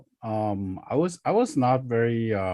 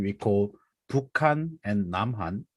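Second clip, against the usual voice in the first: normal talking voice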